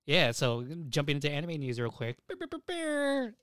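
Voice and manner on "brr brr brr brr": high, slow mock jingle; sound effect. Shown as a demonstration. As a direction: stand in for the news jingle. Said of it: "I don't have the sound effect right now".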